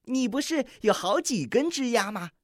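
高声哀求的小鸟; 软软地求一求; 梅花雀祈求. A narrator's reading. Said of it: "梅花雀祈求说". (梅花雀高声恳求小鹿)

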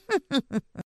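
soft gloating witch laugh; evil chuckle. Acted. (得意的坏笑)

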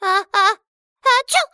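high wind-up then a big sneeze; about to sneeze. Acted. Sneezing dwarf.

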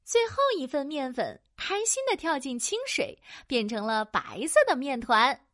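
plain reading voice

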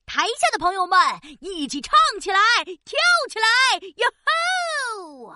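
rally the audience to sing along; excited cheering. Acted. Rousing the audience to join.

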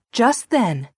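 ordinary delivery

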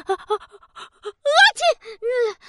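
choking up before a sneeze; pepper-choked mom. Acted.

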